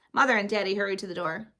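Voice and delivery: usual speaking voice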